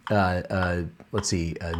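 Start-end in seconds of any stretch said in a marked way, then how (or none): none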